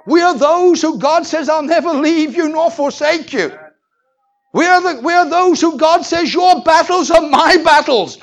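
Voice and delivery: loud, high, ringing sermon cry; fiery preaching. Acted. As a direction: thunder it at the congregation. Preaching at full force.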